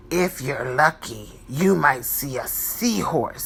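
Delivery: a calm old sea captain, voiced low; Captain Joe voice.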